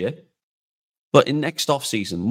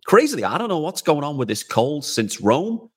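ordinary delivery

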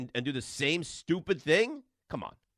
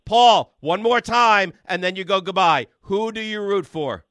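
loud final warning, last words slowed; shouting ultimatum; 3 semitones higher, 6 dB louder, slower than usual. Real, not acted. Host giving rude caller a last shouted chance. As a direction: hammer each word before hanging up. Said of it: "out yell and out talk me".

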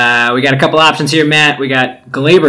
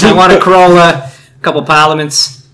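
mock Boston accent; 6 semitones higher, 6 dB louder than usual. Mock Boston customer line.

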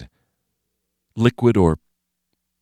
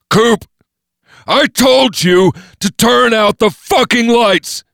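high, slow, furious scream at his friend; furious yell; 8 dB louder, slower than usual. Acted. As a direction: bellow it, dragging every word out. Jeff hurls clock, yells.

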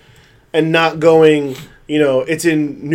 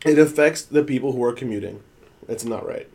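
low, quiet stubborn grumble; salty; 6 semitones lower, 7 dB quieter than usual. Real, not acted. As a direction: sulk it out under breath. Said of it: "Why are you so salty".

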